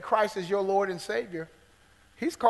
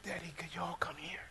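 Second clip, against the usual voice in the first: mild whisper; 2 semitones lower, 15 dB quieter than usual. Retold or imitated. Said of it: "He's crying".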